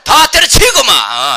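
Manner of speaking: loud, drawn-out Gyeongsang outburst; high, slow, forceful Gyeongsang shout; Gyeongsang dialect. Shown as a demonstration. Preacher urges disciples to quit everything, brash Gyeongsang accent. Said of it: "차라리 경상도 말".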